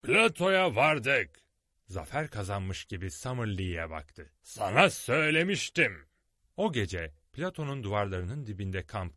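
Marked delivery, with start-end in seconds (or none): 0.0-1.3 high, loud, slow; triumphant. A narrator's reading. Challenger at the plateau, triumphant call. 4.4-6.1 high, loud gloating. A narrator's reading. Challenger gloating at Summerlee, loud and high.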